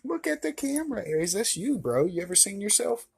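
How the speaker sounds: sing-song high call to a shy dog; high pet-talk voice. Real, not acted. Talking to his dog.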